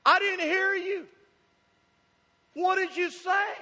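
put-on child feigning confusion after ignoring the parent, puzzled. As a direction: act baffled, as if you truly missed it. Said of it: "with a puzzled look on their face going".

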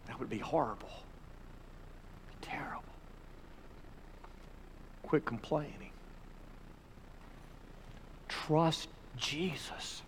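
very soft, grave delivery; hushed appeal. Real, not acted. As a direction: drop right down for a quiet, weighty plea. Preacher drops to hushed, grave appeal.

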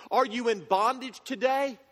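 ordinary talk

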